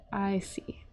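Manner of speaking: unvoiced whisper. Real, not acted. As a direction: breathe it to yourself. Whispered aside.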